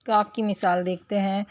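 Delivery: usual speaking voice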